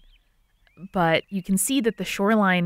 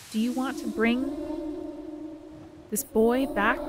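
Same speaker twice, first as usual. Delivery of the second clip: higher, soft, unhurried otherworldly voice; floating goddess voice; 5 semitones higher, 6 dB quieter than usual. Acted. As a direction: speak to petitioners with long, eerie pauses. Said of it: "And she says".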